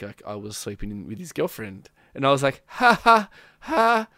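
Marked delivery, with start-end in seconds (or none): 2.7-4.1 high, loud forced ha-ha; fake nervous laugh. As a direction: act out laughing along uneasily.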